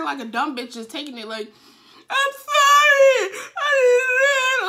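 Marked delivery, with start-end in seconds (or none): 2.1-4.7 hammed-up crying wail, high and drawn out; mock bawling at full volume; wailed apology. Girl apologizing to cheating boyfriend, wailing. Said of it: "like, I'm apologizing".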